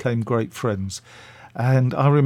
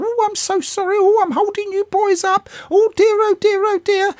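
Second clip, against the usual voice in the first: much higher put-on voice of an elderly actress, apologising; Patricia Hayes; 3 dB louder than usual. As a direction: pitch it way up and fuss over being late. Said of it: "and she said".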